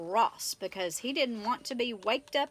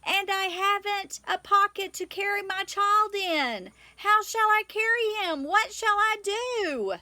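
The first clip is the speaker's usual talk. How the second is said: high, loud desperate shout; screaming up; 9 semitones higher, 5 dB louder than usual. Acted. Katy shouting up to the deaf owl, desperate high scream. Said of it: "screamed at him".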